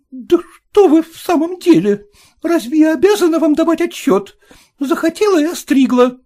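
high, loud, fast retort; lady's voice, indignant; indignant outburst. A narrator's reading. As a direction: refuse to answer him. Lady indignant.

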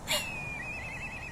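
a short, soft laugh pitched very high; high teasing laugh. Acted. Vixen mocking the young fox's progress, soft high titter. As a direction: tease him with a quick, high titter. Vixen teases fox as childish.

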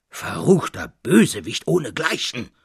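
loud, raging shout; furious shout. A narrator's reading. Old Berglinger shouts in fury at the intruder. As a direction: hurl the insult at him. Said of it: "schrie der alte Berglinger".

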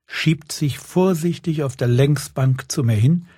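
ordinary delivery, nothing marked

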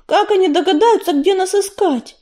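anxious question, pitched high; fret, drawing out the last words; worried. A narrator's reading. Листвичка worries where ancestors will look for the clan, anxious. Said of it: "заволновалась Листвичка".

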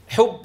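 حب with a breathy "f"-like end. Shown as a demonstration. Teacher shows the mistake, ب like ف in حب. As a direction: let air escape on the ب of حب, toward "f". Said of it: "خرج صوت شبيه بالفاء".

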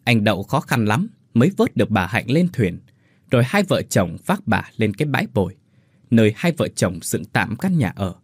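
ordinary delivery

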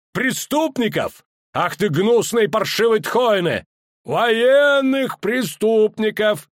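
high, slow, loud outcry; furious shout. A narrator's reading. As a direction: hurl the word, then the insults. Elf shouting insults. (яростный крик)

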